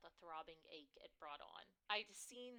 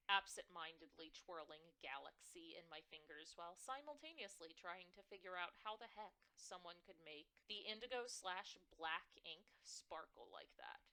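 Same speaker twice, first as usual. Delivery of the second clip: ordinary delivery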